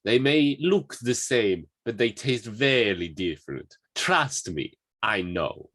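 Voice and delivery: slow, high, hammed-up Italian accent; Italian accent impression. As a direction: relish it in a big theatrical Italian voice. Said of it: "I thought that was a really good impression".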